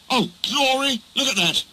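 high, startled cry; alarmed exclamation. A narrator's reading. Driver spotting a train ahead, alarmed.

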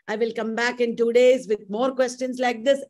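ordinary talk

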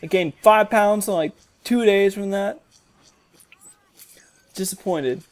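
usual speaking voice

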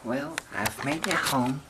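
ordinary delivery

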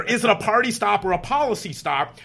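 high, loud retold yell. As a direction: push the repeated question out forcefully, mimicking a shouter. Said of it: "and he yells louder".

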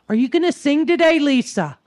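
high, loud neighborly call; imitating neighbor. Neighbor asking Lisa to sing from behind the plants, calling out. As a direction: call out to someone unseen, playfully imitated. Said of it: "She goes".

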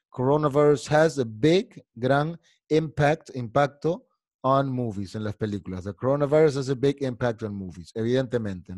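ordinary talk, nothing marked

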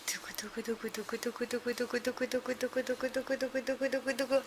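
soft rolling drumroll by mouth. Pretend. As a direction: build suspense with a drumroll. 결과 직전 드럼롤. (입으로 내는 드럼롤)

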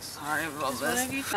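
low, small, slow apology; quiet mock-weepy mumble; retold tearful apology. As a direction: shrink away while acting out an embarrassed cry. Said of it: "crying at her booth".

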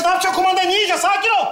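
high, agitated telling-off; alarmed scolding. Acted. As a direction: snap at him to calm down. Father restrains his frantic son.

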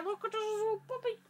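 high cooing puppy talk. Real, not acted. Talking to toy puppy.

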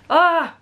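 a sudden high, loud gasp of being moved; emotional gasp. Real, not acted. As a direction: let out a choked-up "ah" mid-reading. Moved while reading fan's note.

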